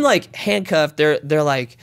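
normal talking voice

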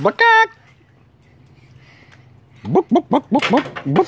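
high squawky bawk bawk; chicken clucking. Retold or imitated.

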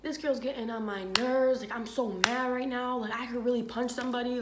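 mock angry boyfriend. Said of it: "He was like".

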